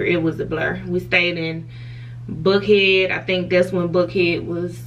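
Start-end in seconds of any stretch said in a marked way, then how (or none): none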